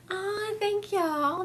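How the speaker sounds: high cooing gratitude; sweet, high "oh, thank you"; touched thanks. Real, not acted. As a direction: gush it, voice pitched well up. Thanking viewers for gifts on stream.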